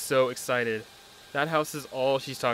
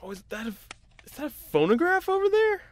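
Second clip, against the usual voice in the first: light up at the sight of it; surprised discovery; 13 semitones higher than usual. Acted. A husband spots an old phonograph in the attic, surprised and much higher.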